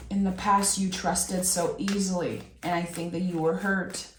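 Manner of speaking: ordinary talk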